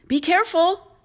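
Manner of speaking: high, loud motherly warning; calling out. A narrator's reading. Mom to Jack climbing the beanstalk, high warning call. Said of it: "called his mom".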